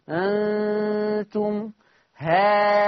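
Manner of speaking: هانتم with alif held six counts and nasal nun; six-count madd. Shown as a demonstration. Teacher shows the long madd for students. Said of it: "همد ست حركات".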